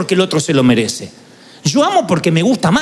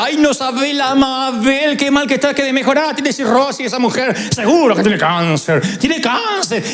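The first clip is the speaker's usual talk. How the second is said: gossiping aunt; 5 semitones higher than usual. Retold or imitated. Aunt relishing another woman's illness, mock gossiping whine.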